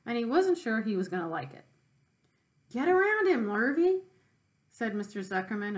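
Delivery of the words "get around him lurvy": called out high and loud across the yard; a farmer shouting to his hand; farmer calling out. Acted. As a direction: shout the order to the hired man. Farmer calling to hired man.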